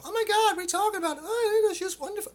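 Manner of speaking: very high, bright mock girly voice; high female chatter. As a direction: squeal it up high like an excited woman. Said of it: "female voices ... inflect more into their upper resonances".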